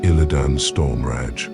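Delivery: ordinary talk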